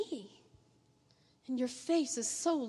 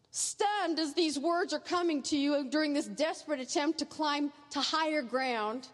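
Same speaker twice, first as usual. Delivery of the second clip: ordinary delivery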